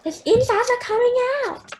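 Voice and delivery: Grandma's shrill-pitched terrified yell. Acted. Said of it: "yelled Grandma".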